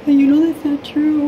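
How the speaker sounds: mimicking her partner's dismissive retort, mock voice; mocking boyfriend. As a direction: throw his brush-off back, exaggerated. Voicing boyfriend's dismissive reply.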